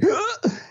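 a sharp high hiccup gasp; mock hiccup gasp to show how bad it was; imitated hiccup. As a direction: mimic a violent hiccup. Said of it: "I was talking".

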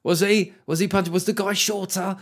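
high mock-outraged questions; comic mimicking online critics questioning the punch. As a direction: parody the pearl-clutching commentators. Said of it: "People say".

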